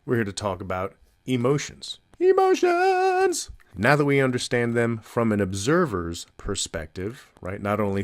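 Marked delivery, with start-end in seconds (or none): 2.2-3.5 very high, drawn-out squeal of a word; mock dramatic cry. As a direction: squeal it slowly and loudly for comic effect. Comic echo of the topic word.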